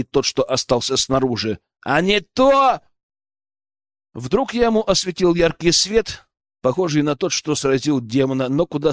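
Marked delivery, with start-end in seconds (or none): 1.8-2.8 raised, higher-pitched threat; barked threat. A narrator's reading. Guard warns prisoners to get into corners, barked threat. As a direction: threaten with the voice lifted. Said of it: "рявкнул третий".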